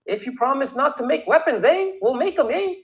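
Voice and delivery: lightly hammed Canadian accent; mock Canadian. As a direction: play the overly agreeable Canadian, eh.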